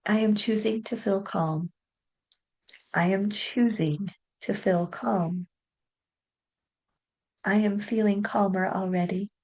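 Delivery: soft, even affirmation voice; soothing self-talk while tapping; calming affirmations. Acted. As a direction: repeat each affirmation gently, letting it settle.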